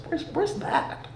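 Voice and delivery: lightly voiced baffled stranger; mock confused local. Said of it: "Yo, where you from?".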